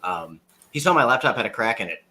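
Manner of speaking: ordinary talk, nothing marked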